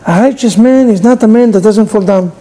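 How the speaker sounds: ordinary talk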